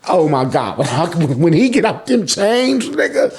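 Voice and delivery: normal talking voice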